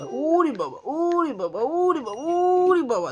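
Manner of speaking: repeated swooping cooed exclamations; singsong pretend shock; playful mock alarm and wonder. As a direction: fuss over a baby with mock amazement, stretching the first word. Playful exclaiming at baby's effort.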